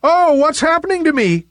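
high, panicked cloud voice; acted squeal of fear; frightened cry. A narrator's reading. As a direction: cry out in alarm, very high, then rush the question. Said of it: "cried Nimbus in fear".

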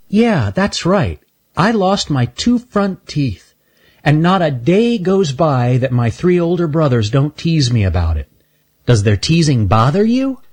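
put-on a cheerful little girl, unbothered; Shelby's voice. Shelby speaks to Stanley.

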